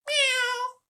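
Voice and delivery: very high, loud mock cat whine; tiger whine imitation. Shown as a demonstration. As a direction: play the snarly tiger cry as a meow. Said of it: "Something like that".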